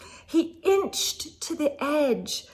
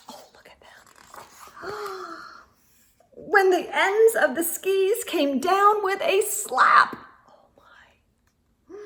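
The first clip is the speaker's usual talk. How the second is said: hushed aside; 6 semitones higher than usual. Real, not acted. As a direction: breathe it out in suspense. Reader aside at dangling characters.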